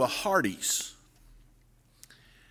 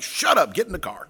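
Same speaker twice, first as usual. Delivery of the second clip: mild mock snap; 6 semitones higher, faster than usual. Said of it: "she's like".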